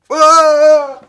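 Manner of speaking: sudden loud shriek; startled scream. Pretend. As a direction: scream in fright. Startled by pretend FBI knock.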